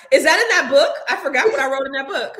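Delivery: high laughing question; amused, laughing at herself. Real, not acted. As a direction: laugh through the words. Laughing at own book.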